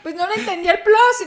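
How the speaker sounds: loud, high "aha" outburst; sudden realisation. Retold or imitated. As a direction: exclaim as the penny drops, amused. Retold moment of sudden realisation.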